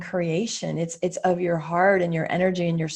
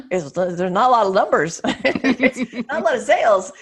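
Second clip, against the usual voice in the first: laughing; 3 semitones higher, 6 dB louder than usual. Real, not acted. Laughing at flopped launch numbers.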